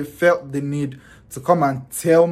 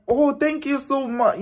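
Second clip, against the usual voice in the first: high, sweet, polite thank-you; a sugary high thank-you to a stranger; 9 semitones higher than usual. Retold or imitated. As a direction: play it overly gracious and high.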